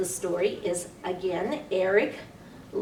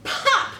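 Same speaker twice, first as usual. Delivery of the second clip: high, sharp popping sound; loud pop; 13 semitones higher, 5 dB louder than usual. Acted. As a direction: make the button burst. Button popping sound effect.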